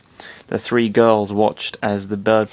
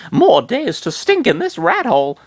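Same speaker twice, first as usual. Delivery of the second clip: put-on high-pitched girl voice, grumbling; girly complaint; 12 semitones higher, 4 dB louder than usual. Girl complaining to her friends, high and whiny. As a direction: whine about the stinking rat hole.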